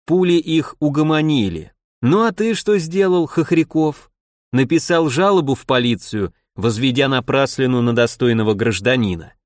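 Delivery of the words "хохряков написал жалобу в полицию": plain reading voice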